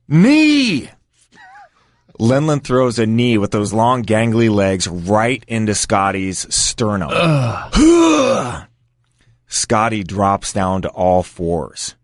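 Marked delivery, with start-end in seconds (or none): 0.1-0.9 high, loud shouted cue from afar; imitated yell. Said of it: "I hear Chris Wilson yell". 7.1-8.6 high winded grunt; imitated grunt of pain. Imitates reaction to knee in sternum.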